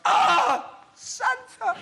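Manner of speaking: mock cry of pain; exclaimed curse. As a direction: yelp as if a finger got trapped. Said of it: "you should go".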